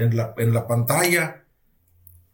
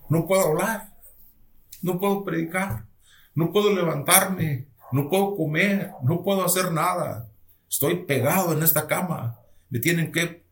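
high-pitched, retold plea; pleading lament; 6 semitones higher than usual. Bedridden patient telling the Lord what he cannot do, pleading. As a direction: plead upward, listing each helpless thing. Sick man pleading with God.

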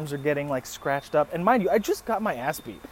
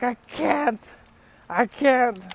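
high-pitched mock pleading; helpless protest; 8 semitones higher, 3 dB louder, much slower than usual. Beaten man answering the screaming girl, mock helpless protest. Acts himself helpless under the beating.

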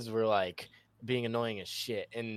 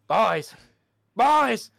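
high, loud repeated shouts; mock frantic yelling; 12 dB louder, much slower than usual. Friend calling the squad for backup, mock frantic yell. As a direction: yell it over and over like a teammate in trouble. Retelling friend's panicked call for help.

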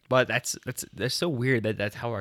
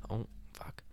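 soft breathy whisper; real whispered curse; 14 dB quieter than usual. Real, not acted. Host after slapping the microphone, whispering. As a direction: hiss it under your breath.

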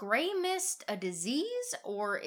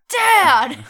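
huge shrill yell of "Dad!"; 11 semitones higher, 17 dB louder than usual. Acted. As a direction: scream "Dad!" at full volume. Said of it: "Be like".

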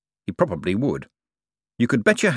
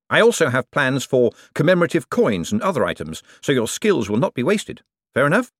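raised, projected speech to workers; smooth boss pitch; 6 semitones higher, 3 dB louder than usual. Acted. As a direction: sell it to the men with assurance. Moist addresses the men.

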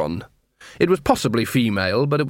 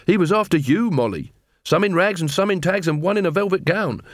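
lightly voiced young watchman, higher pitch; earnest corporal; 7 semitones higher than usual. Corporal explains to the beggar queen who was targeted, earnest. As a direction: reason it out with a lift in pitch.

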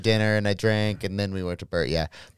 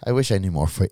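normal talking voice

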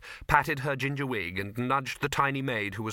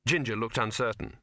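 usual speaking voice

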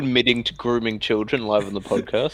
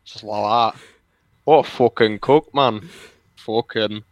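put-on British YouTuber rambling; British lout grumbling about a rival; mock British. British commentator parody ranting about YouTube, mock British. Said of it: "imagine Leafy but British".

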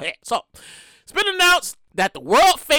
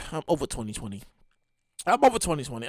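defeated mutter; 13 semitones lower, 8 dB quieter, faster than usual. Real, not acted. Fed up with 2020.

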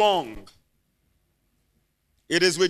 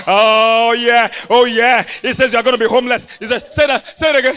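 louder exclaiming; loud preaching; 7 dB louder than usual. Real, not acted. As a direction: call out boldly. Exuberant preaching.

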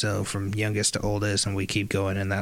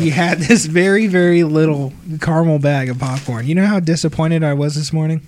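ranting; 4 semitones higher, 7 dB louder than usual. Real, not acted. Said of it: "yeah, that's my rant".

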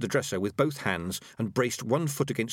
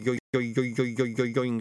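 rapid repeated boinging syllables; rolling, repetitive wobble sound; spinning plate noise. A narrator's reading. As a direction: imitate a plate wobbling round on the floor. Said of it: "sound you always get in these distressing circumstances".

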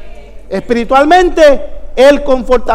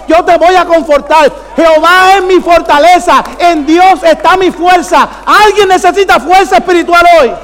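forceful rallying pulpit voice; fervent preaching; 4 semitones higher, 4 dB louder than usual. Real, not acted. Preacher rallying church to find spiritual strength, fervent. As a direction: build to a ringing call.

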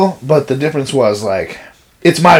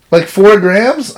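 timid clerk voice; 6 semitones higher, 3 dB louder, much slower than usual. Retold or imitated. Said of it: "She's like".

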